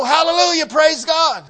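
high, loud hallelujah cry; jubilant praise shout. Acted. Somebody in church cheering while the preacher is burdened, loud praise shout. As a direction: shout praise like an exuberant congregant.

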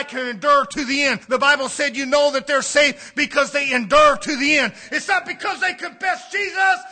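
relentless pulpit cry; full-force preaching. Real, not acted. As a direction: drive each point home to the congregation.

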